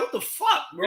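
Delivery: high, squeaky what-the-fuck in disbelief; incredulous friend reacting to a tequila haul; mock disbelief. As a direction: jump way up in pitch, play it stunned. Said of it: "I'm like".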